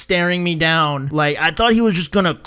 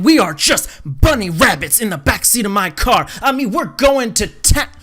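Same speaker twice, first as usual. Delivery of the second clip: mock-excited, rising louder and higher; hammed-up raving; 3 semitones higher, 4 dB louder than usual. Teller inventing a back-seat romp, hammed-up excitement. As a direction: ham up an outrageous tall tale. Hammed-up fake version of the story.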